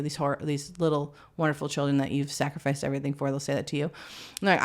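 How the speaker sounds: normal talking voice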